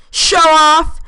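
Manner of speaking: teasing yell. Acted. Turner yells at Graham after his landing, teasing shout. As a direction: shout it after him as a tease. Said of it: "Turner turned and yelled at him".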